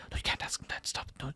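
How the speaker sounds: put-on urgent whispered hush to a child; hushed scolding. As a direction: shush the kid in a whisper. Parents hushing a curious child.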